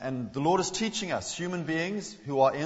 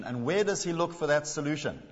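ordinary talk

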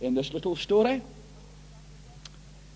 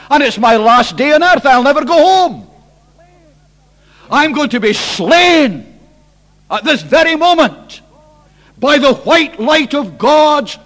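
loud, raised preacher's cry; full-force preaching; 5 semitones higher, 13 dB louder than usual. Acted. Preacher voicing Isaiah's dread in the temple, loud impassioned cry.

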